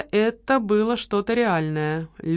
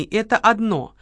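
ordinary delivery, nothing marked

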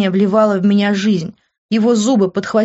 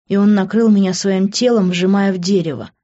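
ordinary delivery, nothing marked

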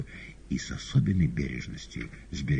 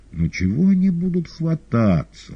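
soothing Koroviev; 13 semitones higher, 7 dB louder than usual. Acted. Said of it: "успокоил Коровьев".

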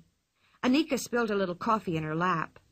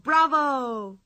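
put-on children's cheer, a bit louder and higher; shouted bravo; 4 semitones higher, 5 dB louder than usual. A narrator's reading. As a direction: cheer it out with clapping joy. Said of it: "clapped their hands and shouted".

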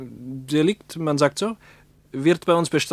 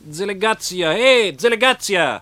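calling out; 5 semitones higher than usual. Real, not acted. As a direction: hail the policeman, stretch the word. Interpreter calls to waving policeman.

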